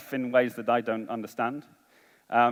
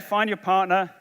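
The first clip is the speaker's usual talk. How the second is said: normal talking voice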